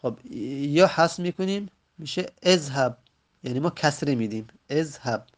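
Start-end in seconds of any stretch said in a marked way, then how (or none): none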